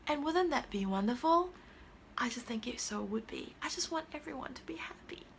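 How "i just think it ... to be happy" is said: whispered, almost to herself; hushed heartfelt whisper; soft whisper. Real, not acted. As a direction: breathe it out like a secret wish.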